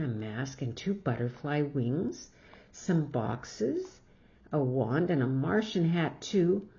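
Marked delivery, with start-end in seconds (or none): none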